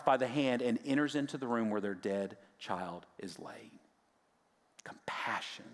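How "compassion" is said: hushed whisper. Acted. Key word of the lesson.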